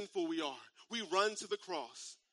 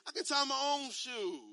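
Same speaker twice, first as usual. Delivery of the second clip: mock offended; 4 semitones higher, slower than usual. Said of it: "I'll be a little bit offended".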